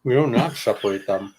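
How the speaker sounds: chewing between words; mouth full. Real, not acted. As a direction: talk around a bite of food. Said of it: "while I'm eating".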